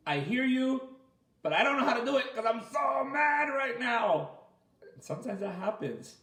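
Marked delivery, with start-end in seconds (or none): 0.1-4.3 higher, whiny mock kid too mad to calm; child answering mom, mildly whiny; upset kid. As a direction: sound like a kid who can't calm down. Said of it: "then it's like".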